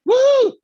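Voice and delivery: high, loud "woo"; celebratory woo; excited whoop. Real, not acted. Celebrates the reworded sentence.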